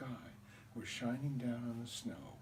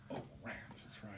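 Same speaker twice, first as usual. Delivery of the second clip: breathy defeated whisper; whispered disappointment; 3 semitones lower, 11 dB quieter than usual. Retold or imitated. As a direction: concede under your breath. Defeated kid muttering.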